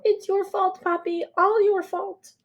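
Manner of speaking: blaming her father with all her hurt; inner yell. Pretend. Daughter wants to yell blame at her father, high accusing cry. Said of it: "I want to yell".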